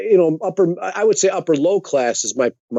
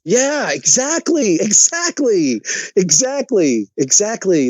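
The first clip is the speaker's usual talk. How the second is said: starts high and slow, then fades down with each repeat; a gleeful "exactly" that winds down; eager drawn-out agreement; 7 semitones higher, slower than usual. Real, not acted.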